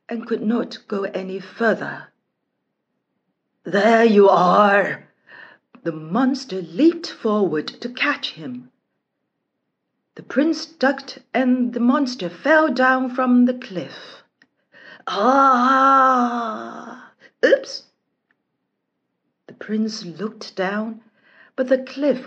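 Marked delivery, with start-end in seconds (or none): 3.6-5.1 loud, slow monster voice; fierce monster roar. Acted. Monster confronting the prince on its island, loud slow roar. 15.1-17.8 falling cry. Acted. Monster falls from cliff.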